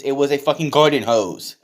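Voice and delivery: ordinary talk, nothing marked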